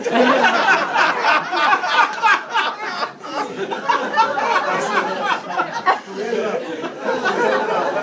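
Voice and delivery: long burst of loud laughter; real helpless laughter; loud high laugh. Real, not acted. Big laugh.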